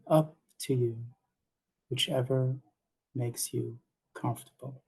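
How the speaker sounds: unhurried meditation guidance with long pauses; calm guide giving breathing cues in short, spaced phrases; guided meditation. Acted. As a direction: lead them gently into relaxation, leaving space between phrases.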